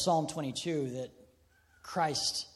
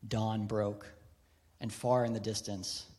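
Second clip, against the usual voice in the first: hushed story reading; 4 semitones lower, 4 dB quieter than usual. Acted. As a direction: read it low and hushed, letting the pauses breathe. Reads novel passage quietly.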